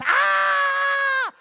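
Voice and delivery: one long, piercing high scream; sustained full-volume shriek; scream of terror. Shown as a demonstration. Host demonstrates the scream for the volunteer, terrified shriek. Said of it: "you scream in terror like this".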